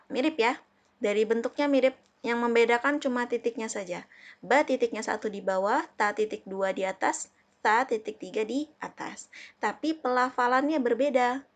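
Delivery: ordinary talk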